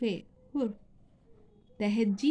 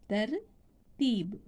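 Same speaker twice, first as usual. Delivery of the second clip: तरतीब said very slowly after spelling it out; slow model word; much slower than usual. Shown as a demonstration. Teacher sums up the spelled example, slowly. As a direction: draw तरतीब out so pupils can follow. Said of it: "मिसाल के तौर पर".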